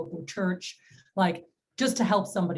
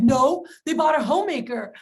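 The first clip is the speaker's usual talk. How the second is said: loud, higher-pitched exasperated retort; imitated mad protest; 6 semitones higher, 6 dB louder than usual. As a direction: imitate someone worked up, pushing louder and higher. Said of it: "Why are you so mad?".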